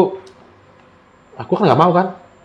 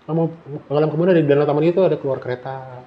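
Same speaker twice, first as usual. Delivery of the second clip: ordinary delivery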